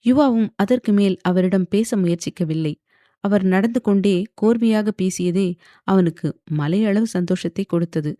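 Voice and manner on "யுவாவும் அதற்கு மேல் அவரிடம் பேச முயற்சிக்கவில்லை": ordinary delivery, nothing marked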